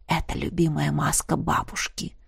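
hushed, secretive whisper; conspiratorial whisper. Acted. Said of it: "заговорщицки прошептал Диего".

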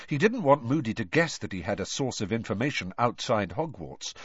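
plain reading voice

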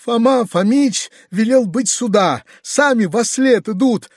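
high-pitched, wailing report; mournful. A narrator's reading. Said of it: "отвечал скорбный Гаврила". (скорбно причитая)

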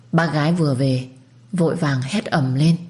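ordinary delivery

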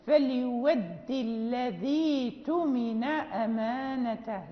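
فليود الذي اؤتمن recited slowly, the long i of الذي dropped; recited example. Shown as a demonstration. Teacher reciting a verse to the students. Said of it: "مثلًا".

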